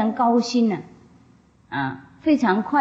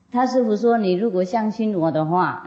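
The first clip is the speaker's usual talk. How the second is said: ordinary talk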